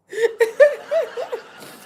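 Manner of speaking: high burst of real laughter; loud amused laugh. Real, not acted. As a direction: let out a genuine laugh. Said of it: "I'll be laughing".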